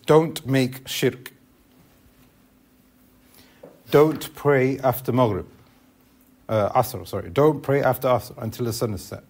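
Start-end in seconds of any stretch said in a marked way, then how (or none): 0.1-5.5 slow, firm command with each word weighted; emphatic command. Shown as a demonstration. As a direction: lay down the order slowly, with weight. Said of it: "An emphasized or emphatic command".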